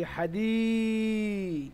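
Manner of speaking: "হাদি" with a very long final i; "হাদি", last vowel drawn out; long vowel spelling. Shown as a demonstration. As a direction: hold the i of "হাদি" on a steady note. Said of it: "দালিয়া জের দি".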